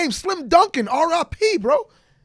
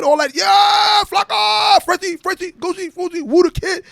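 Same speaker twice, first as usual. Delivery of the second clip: loud hype shout; 8 semitones higher, 6 dB louder, slower than usual. Real, not acted. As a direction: yell the crew names out. Hyping the crew names.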